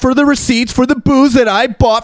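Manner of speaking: very high hammed-up outraged voice; mock indignation. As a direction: protest innocence in a squeal of fake shock. Acting out homeowner feigning innocence.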